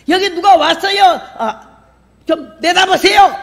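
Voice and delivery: high, loud call across the house; shouting for husband. Retold or imitated. Wife shouting to her husband about a visitor, high and loud. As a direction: yell for him to come out to the guest. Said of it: "왜 이렇게 소리를 질러".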